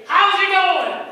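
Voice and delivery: loud, high, slow friendly hello; cheery greeting. Acted. Greeting a stewing man, loud and cheery. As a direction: call out a bright how's it going.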